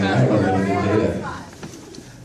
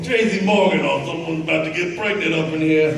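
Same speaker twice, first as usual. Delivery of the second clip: higher, laughing celebrity impersonation; Tracy Morgan impression; 7 semitones higher than usual. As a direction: riff like the comic mid-bit, higher and laughing. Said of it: "this great Tracy Morgan".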